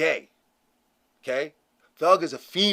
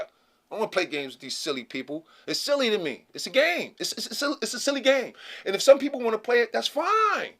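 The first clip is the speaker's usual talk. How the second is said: ordinary delivery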